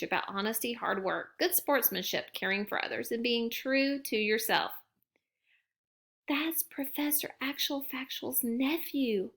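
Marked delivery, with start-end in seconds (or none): 6.3-9.3 whispered. A narrator's reading. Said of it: "Mama whispered to Gran".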